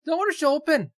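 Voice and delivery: high, loud announcer imitation; put-on high announcement voice; mock lift announcement.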